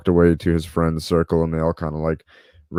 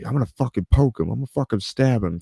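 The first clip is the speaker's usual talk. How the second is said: mock angry threat; 6 semitones higher, faster than usual. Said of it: "And he's like".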